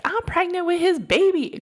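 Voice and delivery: very high imitation of a furious friend; outraged mock yell. Pregnant friend telling off the other girl, high outraged mock yell. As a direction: throw it out way up high. Friend yells at rival, acted quarrel.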